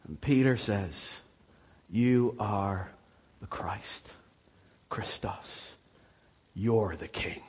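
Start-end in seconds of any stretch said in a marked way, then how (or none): 1.9-7.4 low, soft, with a whispered Christos; hushed confession. Acted. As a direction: breathe the words like a secret.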